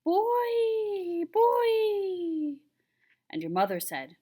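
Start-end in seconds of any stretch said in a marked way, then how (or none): 0.0-2.6 put-on high, loud wailing wind calling boy; a ghostly wind shrieking the name; wind crying. A narrator's reading. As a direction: cry out like a howling storm wind. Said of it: "a wild storm came out of the mountains, crying".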